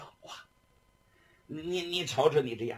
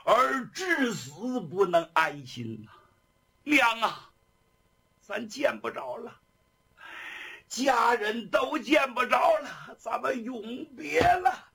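slow, high, sobbing lament; tearful farewell; 6 semitones higher than usual. A narrator's reading. Condemned son bids his mother farewell, tearful. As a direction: wail a last goodbye. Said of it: "那个眼泪吧嗒吧嗒吧嗒吧嗒下来".

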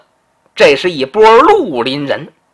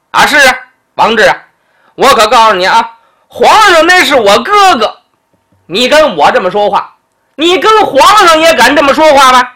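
fast then loud and high bluster; blustering threat; 5 semitones higher, 4 dB louder than usual. Acted. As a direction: throw the emperor's name around to cow him. Duke threatens, emperor is brother. (咋呼着高声恐吓)

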